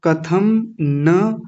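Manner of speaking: कथम न ज्ञेयम very slowly; slow Sanskrit drill; slow recitation. Shown as a demonstration. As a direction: draw out each word for learners. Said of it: "ज्ञेयम नहीं".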